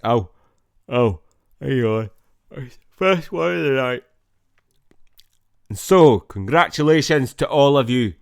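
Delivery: a booming Scottish welcome; Scottish accent. Acted. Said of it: "a Scottish accent".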